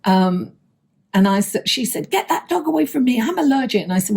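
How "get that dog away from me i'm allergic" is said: mock high, quick scolding; high-pitched impression of an angry complainer; irate older woman. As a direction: imitate a woman having a go at you, pitched up. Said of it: "she was going for me".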